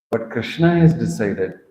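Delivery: ordinary delivery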